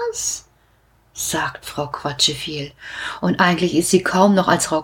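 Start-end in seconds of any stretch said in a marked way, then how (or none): 0.1-2.7 much softer, barely a what; small and hushed with shock; gone quiet. A narrator's reading. Nosy woman stunned by magic mushrooms, hushed. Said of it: "So stille ist sie geworden".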